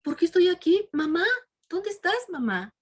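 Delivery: put-on high, bewildered voice of a boy waking up; young man waking confused. Revived son calls for his mother, high and bewildered. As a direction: ask it lost and high-pitched, calling for mom. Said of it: "Yo creo que a lo mejor dijo".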